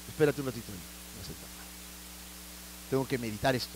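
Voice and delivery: stunned quiet. Acted. Stunned Philemon sits down.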